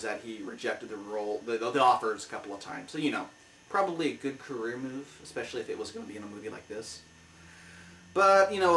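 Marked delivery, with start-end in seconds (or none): none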